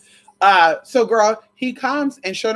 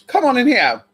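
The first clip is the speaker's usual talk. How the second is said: higher, louder "Come on in here"; loud call; 6 semitones higher, 4 dB louder than usual. Shown as a demonstration. Calling someone in, loud higher call.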